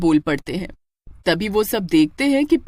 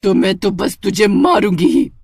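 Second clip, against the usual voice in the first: louder, hard witch refusal; fierce refusal; 4 dB louder than usual. Acted. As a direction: shut him down and threaten. (पति को मना करती चुड़ैल, गुस्से में)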